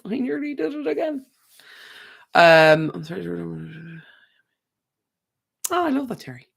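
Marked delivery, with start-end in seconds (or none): 0.0-1.2 exasperated. Real, not acted. Repeats it aloud, exasperated.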